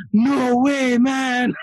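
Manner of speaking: high, loud imitated Canadian accent; excited Canadian with drawn-out A's. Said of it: "a lot of A's, you know, he was like, this guy was, like, super Canadian".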